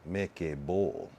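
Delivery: slow "bowl" with the O drawn out; dragged vowel. Shown as a demonstration. Practising the phrase, dragging the O slowly. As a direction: stretch the O of "bowl" long. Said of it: "Drag the O".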